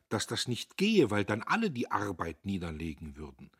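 usual speaking voice

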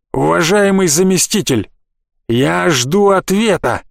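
строго и медленно, требуя ответа; стальной тон главы города; сурово. Acted. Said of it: "сурово проговорил глава города". (сурово, медленно, с нажимом)

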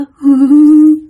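loud, high wordless moaning sob; imitated ghost crying. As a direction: wail and moan like a weeping ghost. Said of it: "giống như nó khóc vậy đó". (tiếng ma rên khóc)

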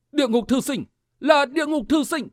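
high-pitched narrated call-out; crowd's cry. A narrator's reading. Fighters take up the cry on the battlefield, calling out. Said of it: "Một người hô mấy người hưởng ứng".

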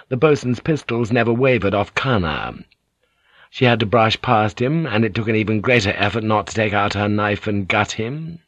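usual speaking voice

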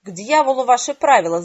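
loud furious yell. A narrator's reading. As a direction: roar it in rage. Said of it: "заорал Ган".

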